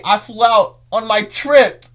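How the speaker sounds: "I flew out on my trip." said high, loud and slow; excited. Real, not acted. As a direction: stretch "trip" out with growing glee. Repeated with more excitement.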